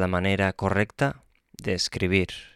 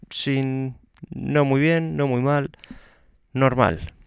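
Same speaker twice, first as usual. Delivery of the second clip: "no muy bien, no muy mal" half-hearted and higher; so-so shrug; 6 semitones higher, slower than usual. Shown as a demonstration. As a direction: shrug it off, meh. The honest so-so answer.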